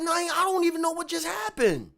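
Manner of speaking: heated disbelief, voice climbing; losing my cool. Retold or imitated. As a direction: let the anger climb as you insist. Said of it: "I start losing my cool".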